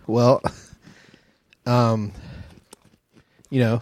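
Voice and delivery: hesitant, reluctant answer; unenthusiastic. Pretend. Croquet winner asked about the prize game, unexcited. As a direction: stall with long, unexcited pauses. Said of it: "You don't sound very excited".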